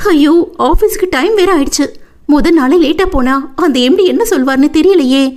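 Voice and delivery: put-on high-pitched, anxious young woman; worried wail, pitched well up; flustered panic. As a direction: fret that you are late for the boss. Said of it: "படபடப்பாக அவள் நினைத்துக் கொண்டிருக்கும் போதே".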